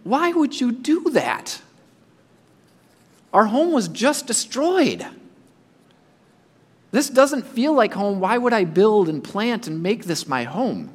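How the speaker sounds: a lightly voiced, puzzled Israelite asking why; a hint of an exile's dismay; bewildered exile. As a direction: question it like a displaced Israelite. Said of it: "I'm thinking".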